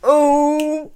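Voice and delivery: loud gleeful "burn"; loud mock-heckle of a burn; mock shout. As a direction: call out "Oh, burn" like a cheering fan. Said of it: "I was like".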